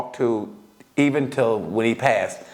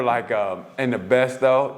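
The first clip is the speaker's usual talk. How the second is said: normal talking voice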